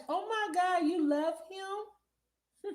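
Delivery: high, loud and drawn-out gasp of disbelief; real shriek of surprise, stretched very slowly; shocked delight. Real, not acted. Reacting to viewer loving her shooter.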